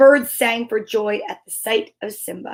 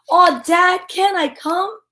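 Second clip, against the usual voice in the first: high, pleading little lion; whiny cub; 8 semitones higher than usual. Acted. Young cub begs his dad to come along, high and whiny. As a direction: beg like a kid left out.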